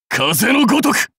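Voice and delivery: high, forceful battle cry; shouted high like a striking warrior; attack call. Acted. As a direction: cry out the technique name.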